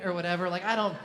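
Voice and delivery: ordinary talk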